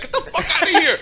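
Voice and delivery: quick, high mock brush-off through laughter; laughing retort. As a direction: laugh while shooing them away. Acting out his own retort.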